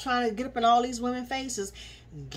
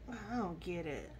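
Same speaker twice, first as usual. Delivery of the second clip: dropped to near mumble; 4 semitones lower, 13 dB quieter than usual. Real, not acted. Calming herself down.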